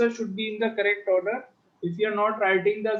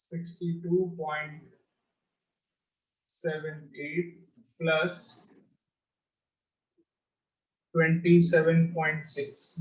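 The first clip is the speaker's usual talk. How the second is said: slow dictation; much slower than usual. Shown as a demonstration. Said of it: "teacher dictating numbers".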